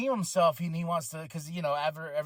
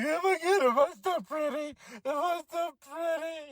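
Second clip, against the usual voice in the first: much higher, dramatic fretting; mock prom queen; 10 semitones higher than usual. Mocking the prom queen after her slap, high and hammy. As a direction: ham up a vain wounded beauty. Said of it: "I like her selling".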